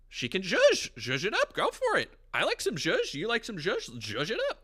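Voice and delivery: high, loud, playful squeals of zhuzh; goofy excited zhuzh. Retold or imitated. Tipsy customer egging on the bartender, high giddy squeals. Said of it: "I'm like".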